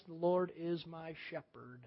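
slow, very soft Scripture reading; slow, paused reading. Shown as a demonstration. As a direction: read it softly, letting the clause settle. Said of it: "You pause between each clause".

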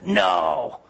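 sudden demon shout, drawn out and low; demonic voice. Retold or imitated. Preacher retells a voice bursting out of a possessed man, demonic shout. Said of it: "a voice came out and said".

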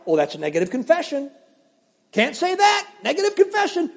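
lightly voiced scolding believer, high on the second part; quoting the old hyperfaith line, half mocking; mock hyperfaith warning. Preacher mimicking his younger self: mock scold. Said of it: "this is what I'd say all the time".

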